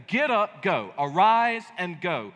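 loud, punched Get up, go; emphatic command. Shown as a demonstration. Preacher voicing God's urgent command to Jonah.

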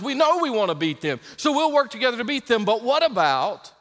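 high, loud pulpit drive; preaching at full force. Acted. Preacher urging the church to unite, fervent and loud. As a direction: push each line up to the back row.

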